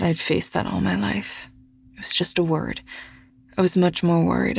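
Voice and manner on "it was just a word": ordinary delivery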